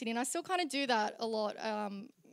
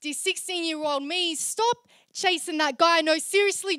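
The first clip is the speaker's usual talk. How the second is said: emphatic open-letter recital; slam poetry; 4 semitones higher, 6 dB louder than usual. Acted. Poet warns younger self, emphatic slam poetry. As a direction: urge it in spoken-word rhythm.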